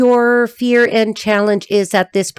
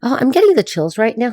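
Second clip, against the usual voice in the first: ordinary talk, nothing marked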